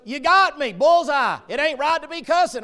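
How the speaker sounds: loud, high shouting; Joe calling out. Retold or imitated. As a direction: holler it back at the preacher. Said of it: "He goes".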